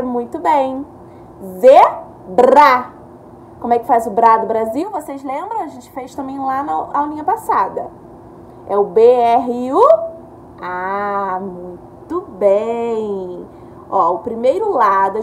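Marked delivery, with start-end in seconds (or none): 1.6-2.9 loud, drawn-out Zebra; stretched syllable read. Shown as a demonstration. Teacher modelling the word loudly for children. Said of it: "É o Z com". 10.6-13.5 slow praise. Real, not acted. Teacher praising a pupil's spelling warmly. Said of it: "praising child's answer".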